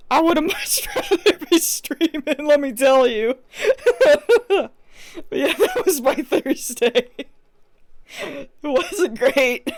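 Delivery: high laughter between and through the words; real giggly wrap-up, words stretched by laughter; laughing. Real, not acted. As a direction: laugh off a miserable day. Laughs off the bad evening.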